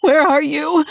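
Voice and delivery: high, loud desperate call; strained, panicked shout; raw, strangled scream. A narrator's reading. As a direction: scream out, searching frantically. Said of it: "My voice is raw and strangled".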